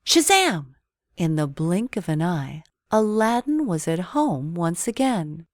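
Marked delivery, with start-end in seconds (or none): 0.0-0.8 high, loud, ringing Shazam; magic word. A narrator's reading. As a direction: announce the magic with a burst. Magic sound of the genie.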